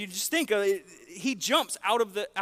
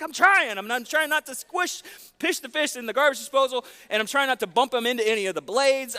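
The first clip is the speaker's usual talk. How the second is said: retold panic; 7 semitones higher, 6 dB louder than usual. Retold panic, hand in disposal.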